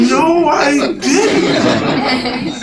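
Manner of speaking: high, loud, stretched mock denial; drawn-out denial. Man denying he said fiancée, comic protest. Retold embarrassed denial to girlfriend.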